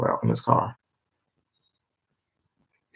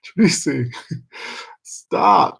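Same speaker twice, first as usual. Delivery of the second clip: high, amused scolding; mock plea; 7 semitones higher than usual. She scolds Tracy to stop, high and amused. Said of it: "I be like".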